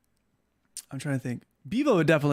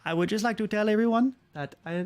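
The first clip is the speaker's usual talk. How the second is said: caller; 5 semitones higher than usual. Pretend. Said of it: "And you're like".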